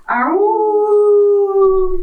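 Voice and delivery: high, loud wolf howl. Acted. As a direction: throw your head back and howl. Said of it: "gives you a proper wolf".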